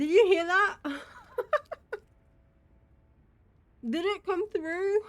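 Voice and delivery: high, loud laughing question. Real, not acted. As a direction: laugh through the words.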